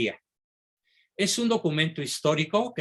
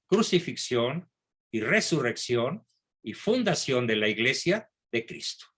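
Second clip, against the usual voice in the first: usual speaking voice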